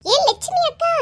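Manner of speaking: high, carrying call across the yard; shrill summons at the door. Acted. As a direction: yell for her neighbour from outside. Calling neighbour out again.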